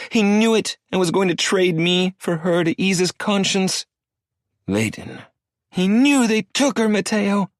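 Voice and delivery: high, loud, distraught yelling; hysterical shouting. A narrator's reading. Wife yells at husband about her stolen baby, hysterical shouting. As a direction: shout it through grief and rage.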